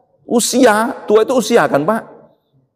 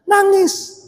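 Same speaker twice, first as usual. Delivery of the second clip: mock listener reply. Answers for the congregation.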